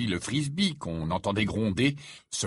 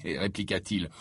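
ordinary delivery

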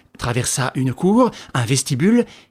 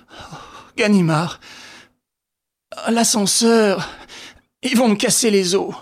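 mild moan; 5 semitones higher than usual. Acted. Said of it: "Lupin gémit".

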